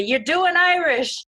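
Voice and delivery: high, drawn-out mock call; retold yell. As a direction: imitate a cousin calling out from across the room. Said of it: "my cousin from across the way yells at me".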